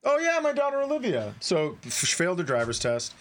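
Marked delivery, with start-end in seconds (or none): 0.0-1.3 high, bright oh-yeah of remembering; a burst of remembering; sudden recall. Real, not acted. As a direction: jump up in pitch as it comes back.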